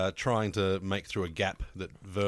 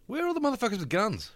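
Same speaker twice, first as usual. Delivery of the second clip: incredulous outburst; 13 semitones higher than usual. Real, not acted. Ranting about Iron Man logic.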